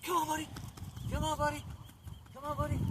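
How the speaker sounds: high-pitched coaxing of the dog; high dog-calling voice. Real, not acted. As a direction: coax the dog over in a high sing-song.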